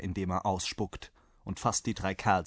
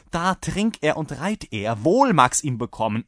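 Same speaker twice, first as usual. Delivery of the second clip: impatient urging, getting louder; exasperated host; 6 semitones higher, 6 dB louder than usual. A narrator's reading. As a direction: hurry the guest along. (ungeduldig drängender Wirt)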